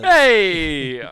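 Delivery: loud, drawn-out Jersey-Italian holler; hammed-up Jersey-Italian shout; mock Jersey Italian accent. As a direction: call out like a cartoon Jersey guy.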